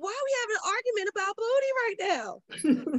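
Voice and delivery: high, incredulous pitch; can't believe this argument; exasperated disbelief. Retold or imitated. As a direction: mom baffled by a toddler. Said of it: "I'm like".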